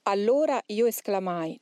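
ordinary delivery

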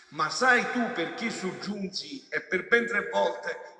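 loud, high, impassioned preaching; preaching at full force. Acted. Preacher voicing Jesus to the faithful, full-force preaching. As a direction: thunder the words out as the voice of Jesus.

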